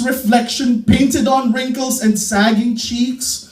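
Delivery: loud, fast, ecstatic Beat poem delivery; Ginsberg-style howl. Acted.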